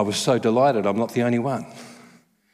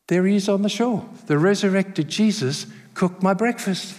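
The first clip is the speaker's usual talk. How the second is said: ordinary talk, nothing marked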